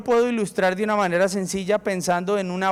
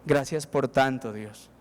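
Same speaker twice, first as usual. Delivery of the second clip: slow, much lower and softer thanks; quiet prayer; 6 semitones lower, 4 dB quieter, slower than usual. Pretend. Humble heart thanks God, slow and hushed. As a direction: pray it slowly, hushed. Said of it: "le dice a Dios".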